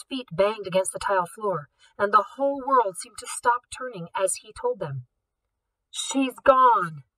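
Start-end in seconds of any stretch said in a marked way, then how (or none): 5.9-7.0 disbelief. Acted. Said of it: "more like disbelief".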